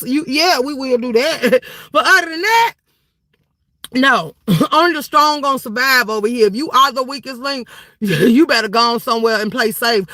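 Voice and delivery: ordinary talk, nothing marked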